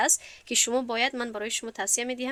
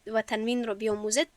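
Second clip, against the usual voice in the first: usual speaking voice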